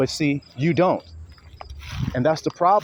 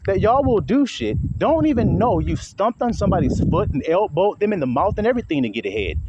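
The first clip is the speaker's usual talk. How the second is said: usual speaking voice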